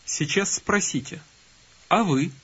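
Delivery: ordinary delivery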